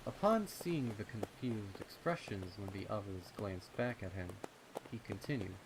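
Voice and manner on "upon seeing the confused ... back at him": ordinary delivery, nothing marked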